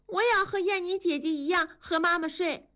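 put-on high-pitched toddler demanding; clamoring small child. A narrator's reading. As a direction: cry out in a little kid's high voice. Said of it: "多多也叫". (小孩子尖声叫嚷)

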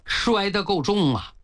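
ordinary delivery

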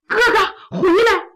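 high, loud call across the yard. A narrator's reading. Mu Guiying calls her brothers back from the doorway, shouting high. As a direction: shout after them to come back. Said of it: "急忙跑到门口高喊".

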